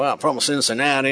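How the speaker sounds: lightly mocking Midwestern accent; playful Midwestern accent poke. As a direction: tease a Cincinnati Midwestern accent. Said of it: "I love to make fun of Midwestern accents".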